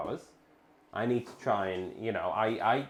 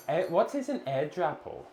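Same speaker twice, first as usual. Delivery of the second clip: ordinary talk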